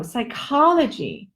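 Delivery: "psychology." with a wide open O in the stressed second syllable. Shown as a demonstration. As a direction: stretch the mouth open on the O of "psychology.". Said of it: "open for that O".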